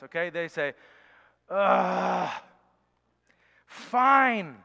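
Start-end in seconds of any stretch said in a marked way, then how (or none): 1.5-4.6 put-on loud, slow, put-upon groan of a sulky kid; begrudging child. Child told to share toys by a parent, answering in a begrudging sulk. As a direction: drag it out like a child forced to share. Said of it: "they kind of begrudgingly".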